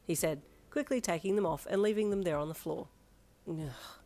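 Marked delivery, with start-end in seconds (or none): 3.5-4.0 soft, weary groan; exasperated groan. Acted. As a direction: groan it quietly. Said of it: "you groaned".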